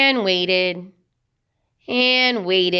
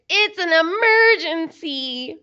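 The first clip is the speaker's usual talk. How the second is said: urgent piglets; 8 semitones higher, 3 dB louder than usual. Acted. Piglets beg for bathroom.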